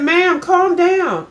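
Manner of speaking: high, slow, soothing mock cashier voice; calm the upset customer down, drawn out; imitating the cashier. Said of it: "And she said".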